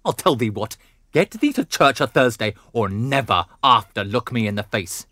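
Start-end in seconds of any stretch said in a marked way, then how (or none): none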